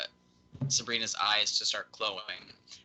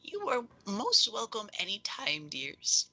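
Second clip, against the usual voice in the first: high, airy character voice; gracious patron, pitched far up; grateful patron; 9 semitones higher than usual. Acted. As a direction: thank them in a high, whispery voice. Game master voices welcoming patron.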